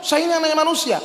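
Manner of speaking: high, indignant refusal; acted angry. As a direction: protest crossly as the offended man. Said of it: "katanya, marah". (protes marah dengan nada tinggi)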